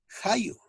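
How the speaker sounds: "jayo" starting on a rasped back-of-tongue j; velar J. Shown as a demonstration. Teacher giving an Aymara J example, velar fricative. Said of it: "Fonema J".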